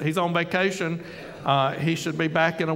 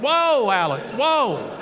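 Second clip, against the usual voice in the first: alarmed whoa; 4 semitones higher than usual. Retold or imitated. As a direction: rein in an excited colleague. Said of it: "I came up almost out of my chair".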